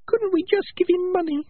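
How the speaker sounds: tiny, very high rat voice; little voice. Acted. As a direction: squeak it as a small, meek creature. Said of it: "It was a little voice".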